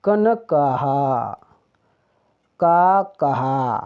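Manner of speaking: कन कहा slowly, final vowel drawn out; slow teacher's demo of कन कहा and का कहा; sounding out words. Shown as a demonstration. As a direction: stretch the long aa in का कहा. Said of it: "की शब्द कैसे लिखें".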